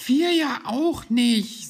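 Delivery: high, drawn-out loud lament; let-down, high-voiced Hoppel; disappointed. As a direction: wail it slowly in a small creature's voice. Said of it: "sagte Hoppel ebenso enttäuscht".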